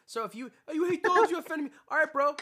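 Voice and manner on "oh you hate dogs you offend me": high, loud, prissy outrage; mock offended. Mocking a dog lover who takes offence, high and loud. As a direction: whine it like a touchy snob.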